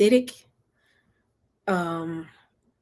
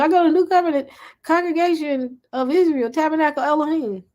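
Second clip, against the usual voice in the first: higher, proud recital of the name; proud church member; 9 semitones higher, 4 dB louder than usual. Pretend. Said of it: "what assembly you go to?".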